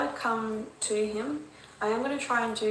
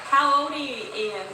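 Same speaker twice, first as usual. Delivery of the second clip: coaxing call; 4 semitones higher, 6 dB louder than usual. Real, not acted. Asking the child spirit.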